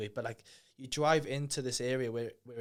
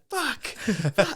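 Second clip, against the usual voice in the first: high, loud panicked curse; panicked swearing; 10 semitones higher, 7 dB louder than usual. Retold or imitated. Nervous skydiver swearing on the climb, high and loud. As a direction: blurt it out in rising panic.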